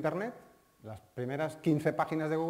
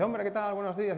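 cheerful waiter; 7 semitones higher, 4 dB louder than usual. Retold or imitated. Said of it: "el camarero te dice".

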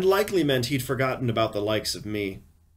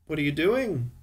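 higher-pitched woman's voice, curious; voiced wife; 5 semitones higher than usual. A narrator's reading. Wife asks husband at his computer, higher voice. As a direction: narrator lightly playing the wife, pitched up.

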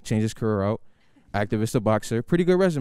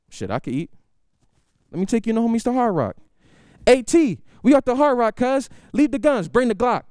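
much higher, louder gang boss; loud gangster uncle; 12 semitones higher, 4 dB louder than usual. Retold or imitated. Uncle ordering A T at Hard Rock, high and loud. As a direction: bark orders over the crowd. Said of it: "my uncle goes".

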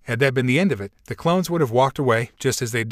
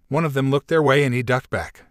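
ordinary delivery

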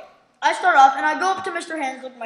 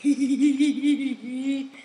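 squeaky string of hee-hees; imitated giggle. Teacher starting to laugh at the joke, imitated giggle. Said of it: "And then she starts laughing".

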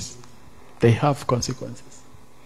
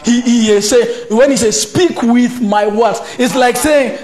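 loud, high, impassioned sermon delivery; fiery sermon voice; fiery preaching; 8 semitones higher, 5 dB louder than usual. Acted. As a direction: preach it with full force to the congregation. Preaching at full force.

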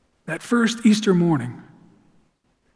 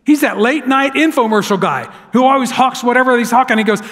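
ordinary talk, nothing marked

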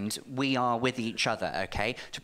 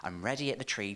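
normal talking voice

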